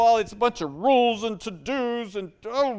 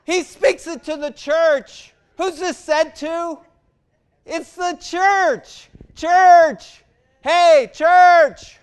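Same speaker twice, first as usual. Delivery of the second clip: louder and higher, calling out Church at the top; full-force preaching; 9 semitones higher, 13 dB louder, slower than usual. Acted. Pastor calling on his congregation, loud preaching.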